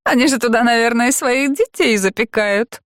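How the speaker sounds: put-on high, horrified young woman's retort; high mock-horrified retort. A narrator's reading. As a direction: gasp it out, scandalised. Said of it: "парировала Фрэнсис".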